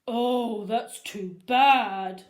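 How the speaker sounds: loud, slow fake pity; mock sympathetic principal. Principal toying with the boys, loud fake sympathy. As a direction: pretend to feel sorry for them. Krupp voiced.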